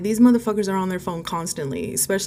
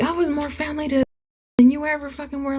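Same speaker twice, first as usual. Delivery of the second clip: high-pitched, indignant retort; heated, high-voiced comeback; cussing him out; 8 semitones higher, faster than usual. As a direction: re-enact telling him off, voice pitched way up. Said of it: "I just start cussing him out".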